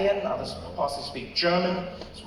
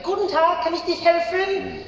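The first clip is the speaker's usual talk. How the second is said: high, sweet shop greeting in German; friendly counter woman; 11 semitones higher, 4 dB louder than usual. Retold or imitated. Woman at the counter greeting customer, friendly. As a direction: imitate a friendly server, pitched up. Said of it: "The woman was really friendly".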